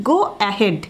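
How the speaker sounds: usual speaking voice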